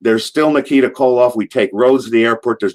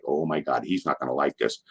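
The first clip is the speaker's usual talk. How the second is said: dropped to a near hush, dreading the reaction; hushed worry; 6 semitones lower, 10 dB quieter than usual. Retold or imitated. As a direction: say it under your breath, scared of the boss. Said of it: "there's a hush over the car".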